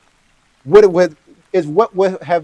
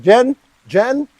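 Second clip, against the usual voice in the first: high, questioning call; imitated searching call; 8 semitones higher than usual. Fiance calls for Jen as he goes inside, searching call. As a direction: call a name uncertainly into an empty house.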